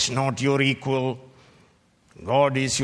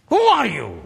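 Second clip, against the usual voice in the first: loud rebuke; 9 semitones higher, 7 dB louder than usual. Acted. Preacher rebukes questioners of God.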